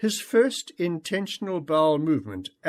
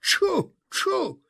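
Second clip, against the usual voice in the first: high, gleeful shout; boy shouting joyfully from horseback; 14 semitones higher, much slower than usual. A narrator's reading. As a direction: call like a happy kid on a galloping horse.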